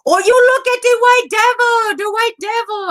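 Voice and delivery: high-pitched, loud mock Rastafarian holler; mock yelling. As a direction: yell it like an accusing street heckler. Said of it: "like, yelling at me".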